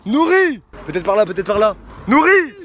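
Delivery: loud high shout across the lake; calling out. Real, not acted. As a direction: yell a name to someone far away. Appelle un ami perdu. (appel crié au loin)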